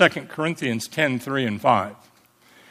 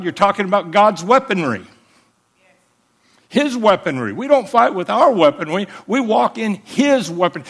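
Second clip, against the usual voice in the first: raised, louder preacher's call to the church; rousing the congregation with the victory message, full preaching voice; full-force preaching; 4 semitones higher, 3 dB louder than usual. Acted. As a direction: drive it home like a revival preacher.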